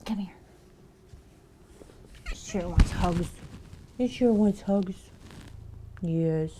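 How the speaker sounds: affectionate cat talk; pet talk. Real, not acted. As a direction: coo over the cuddly cat. Cuddling the cat.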